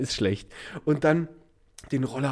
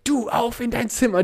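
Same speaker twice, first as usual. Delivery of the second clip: mother sending to room; 7 semitones higher than usual. Retold or imitated. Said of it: "Die eine Mutter brüllt".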